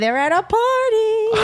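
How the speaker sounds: "They're at a party" high and stretched out; high drawn-out repeat. Pretend. Clue-giver confirming a guess to the player, high drawn-out repeat.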